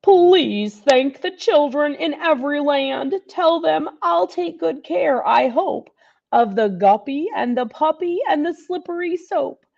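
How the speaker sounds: put-on a grateful old Santa writing thanks; Santa's letter. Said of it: "was written in Santa's hand".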